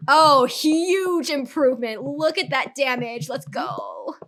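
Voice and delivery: loud, drawn-out gamer hype; excited cheer. Real, not acted. Streamer cheers a huge improvement in the game, excited. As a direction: stretch the words out in excitement. Reacting to big game upgrade.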